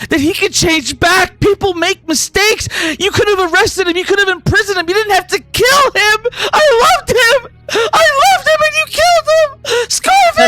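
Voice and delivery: high wail building to a shriek; protest, then break into grieving cries; crying over the body. Acted. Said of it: "crying over him".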